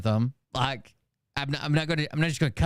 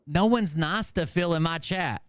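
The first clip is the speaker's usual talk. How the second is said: ordinary talk, nothing marked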